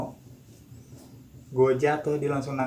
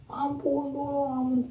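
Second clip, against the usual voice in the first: teriak minta tolong tiruan; 13 semitones higher than usual. Retold or imitated. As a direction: imitate terrified cries for help, held back in volume. (tiruan jeritan minta ampun bernada tinggi)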